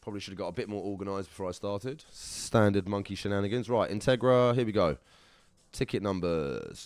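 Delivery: ordinary talk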